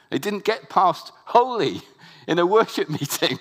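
loud, high impassioned sermon; fiery preacher in full flow; preaching at full force. Acted.

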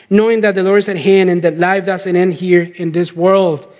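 normal talking voice

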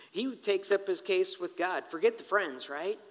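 usual speaking voice

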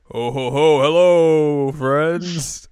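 booming, hammed-up jolly hello; loud jolly greeting. Retelling a character's silly entrance, loud and jolly. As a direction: belt it out like a goofy entrance. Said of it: "she comes to me and is like".

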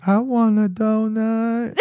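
put-on high, slow little girl asking for a donut. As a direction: mimic a small girl begging sweetly.

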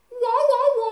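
very high, loud trumpet-like wah wah; mock trumpet wah. Storyteller imitating a sound to break the tension, very high and loud. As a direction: blast it like a cartoon horn. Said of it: "just like this".

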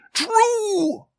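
extremely high, wailing ghost; piercingly high apparition. A narrator's reading. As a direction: sound the word high like a spectre. Ghost speaking to Scrooge.

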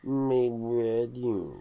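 very slow, drawn-out mournful hound; sad cartoon dog voice. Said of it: "You sound like, uh, the sad dog off of those cartoons".

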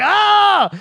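high, loud mock scream of pain; hammed-up cry of agony; loud fake scream. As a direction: fake being hurt with a big yell. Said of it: "screaming louder than her".

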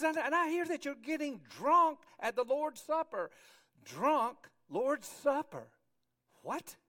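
high, loud, incredulous outburst; appalled disbelief. Acted. Apostle hearing of drunkenness at the Lord's Supper, appalled. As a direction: stretch the repeat in loud disbelief. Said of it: "And he says".

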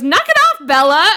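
high, loud exasperated outburst; really annoyed. Retold or imitated. Alice telling Bella off, really annoyed. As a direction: snap it loudly at a friend. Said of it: "she's just really annoyed".

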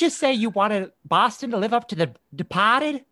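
put-on incredulous drunk Bostonian, high and indignant; Boston accent. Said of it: "And he says".

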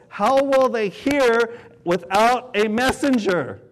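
slow, deliberate preaching read; scripture reading. Acted. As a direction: lay each phrase out weighty. Quoting Romans as preacher.

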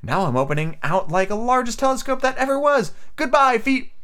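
a hint of a young girl marvelling; Alice. A narrator's reading. Alice voiced by reader.

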